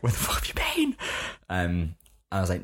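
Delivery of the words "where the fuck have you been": very high-pitched exasperated demand; mock angry mum. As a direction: act out an angry mum, voice shooting up. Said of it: "she was so angry and just like".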